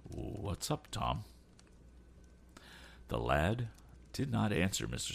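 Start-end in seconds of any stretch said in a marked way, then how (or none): 0.0-1.2 hushed, mostly unvoiced whisper; very soft whispered question. A narrator's reading. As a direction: whisper it cautiously to your son. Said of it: "asked his father in a whisper".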